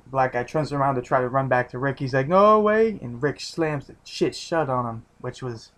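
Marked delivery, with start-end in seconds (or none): 2.3-3.0 higher, louder retold shout; mock panicked cry. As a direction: imitate a man crying out in panic. Retells man's cry as door shuts.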